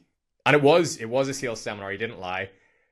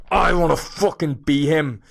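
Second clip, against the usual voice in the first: loud, drawn-out, higher-pitched outburst; fired-up awe; 6 semitones higher, 10 dB louder than usual. Retold or imitated. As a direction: blurt it out with huge, emphatic longing. Retelling excited reaction to film.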